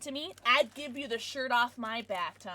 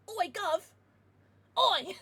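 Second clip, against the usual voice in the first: mock British accent; 6 semitones higher, 4 dB louder, slower than usual. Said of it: "my offensive British accent".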